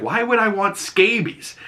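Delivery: high, incredulous question; mock-baffled high voice; incredulous quote. Peacemaker balking at the helmet, high and incredulous. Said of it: "And he's like".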